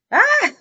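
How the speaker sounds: high, loud shriek; startled scream. Real, not acted. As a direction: shriek in sudden fright. Startled by a fall.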